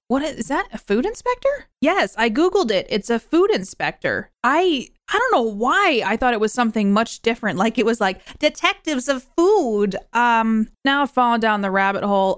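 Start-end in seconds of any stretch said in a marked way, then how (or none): 0.1-1.7 high, questioning lift; incredulous question. Acted. Podcaster questioning the job title, high and incredulous. Acted question to herself, then answered. 8.4-10.0 high, slow, hammed-up announcement; mock-grand title. Podcaster imagining a grander job title, mock-grand.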